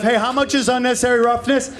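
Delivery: quick mock negotiation building to a punchy cry; eager football player.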